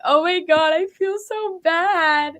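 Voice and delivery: high, drawn-out guilty wail; hammed-up softhearted regret, stretching the last words; mock remorse. Babyface imagines guilt backstage after playing heel, high mock remorse. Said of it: "be like".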